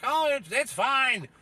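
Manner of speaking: high, slow, smug reassurance; sarcastic high TV-talking-head voice; mocking TV pundit. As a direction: parody a pundit insisting all is fine. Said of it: "some fucking dickhead on T V like".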